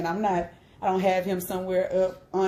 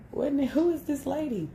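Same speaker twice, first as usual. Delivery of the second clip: surprised; 9 semitones higher than usual. Retold or imitated. Reacting to screaming lady.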